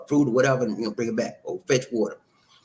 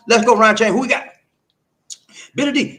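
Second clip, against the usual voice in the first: loud, high hype call to the chat; hype shout-out; 10 semitones higher, 8 dB louder than usual. Real, not acted. Streamer hyping up his chat viewers, loud and high. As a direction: pump up the names like a host.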